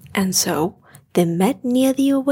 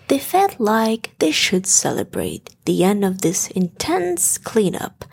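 ordinary delivery

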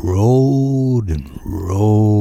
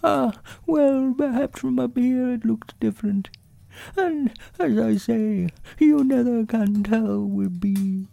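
very high, small voice for a pondering bear; high-pitched bear explaining earnestly; Winnie the Pooh; 5 dB quieter than usual. A narrator's reading. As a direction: speak as a thoughtful little toy bear, high and thin.

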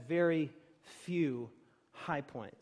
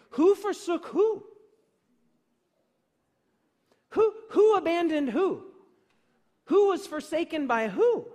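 higher and louder, hammering each question; thundering challenge; 9 semitones higher, 9 dB louder than usual. Real, not acted. Preacher calling out the congregation, loud and high. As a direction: fling the questions out like accusations.

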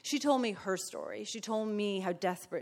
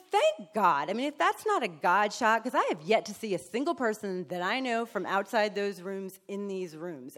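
ordinary talk, nothing marked

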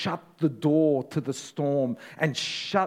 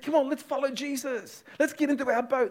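eager crowd; 11 semitones higher, faster than usual. Acted. Crowd eager to follow Jesus.

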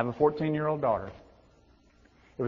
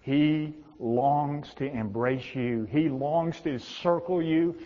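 building preaching; 3 semitones higher, 3 dB louder, slower than usual. Acted.

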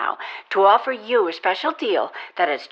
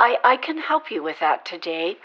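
ordinary talk

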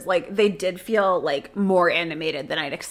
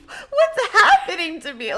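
laughing quote; 10 semitones higher than usual. Retold or imitated. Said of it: "She's like".

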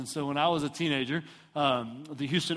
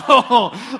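loud, high comic whoa; hammed-up alarmed exclamation; mock alarm; 8 semitones higher, 13 dB louder than usual. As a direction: cry out like a startled passenger, half laughing. Alarmed passenger as mom speeds.

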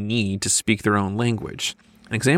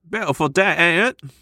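high-pitched, broad Cockney; Cockney accent; 7 semitones higher than usual. Acted. Two friends chatting about the weather, exaggerated Cockney accent. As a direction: lay the Cockney on thick. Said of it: "You're spiraling into Cockney accent land".

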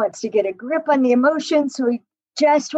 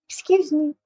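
put-on cute boyish excuse me; 4 semitones higher than usual. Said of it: "the most adorable "excuse me" ever".

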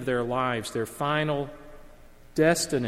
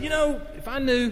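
higher, boyish voice, imitating a kid; seventh grade boy; 10 semitones higher than usual. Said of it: "seventh grade guys talking now".

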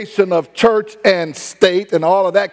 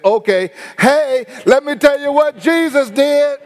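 excited healed man; 7 semitones higher than usual. Acted. Healed blind man tells everyone.

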